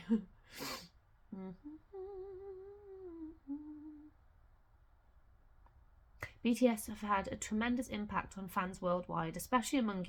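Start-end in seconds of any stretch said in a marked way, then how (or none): 1.3-4.1 breathy high-pitched giggle; soft high giggle. Real, not acted. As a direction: giggle quietly at the cat. Cat misses the snowflakes.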